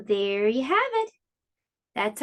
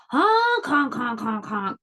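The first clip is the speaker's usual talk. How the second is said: laughing gander honks. Acted. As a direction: honk like a goose mocking. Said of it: "the gander laughed".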